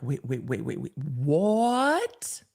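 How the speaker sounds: long drawn-out slow rising what; slow disbelieving wait what; stunned disbelief. Retold or imitated. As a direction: stretch it out in shock. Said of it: "I'm like".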